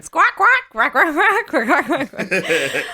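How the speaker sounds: high loud laughing quacks, last ones slow; imitating a friend's bird chatter for laughs; mock bird squawks. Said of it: "Zach's just going".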